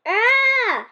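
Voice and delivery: short high mock scream; a cartoon yelp. A narrator's reading. A friend screams as the slime swallows them, high yelp.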